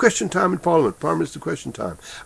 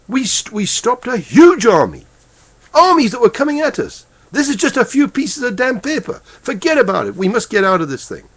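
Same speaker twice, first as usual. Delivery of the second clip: real anger; 6 semitones higher than usual. Real, not acted. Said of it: "I'm very angry about it".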